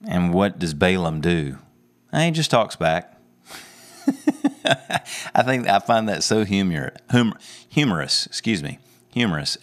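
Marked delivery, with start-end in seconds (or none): 4.1-5.3 short breathy chuckle; amused little laugh. Real, not acted. Preacher amused by the talking donkey, chuckling.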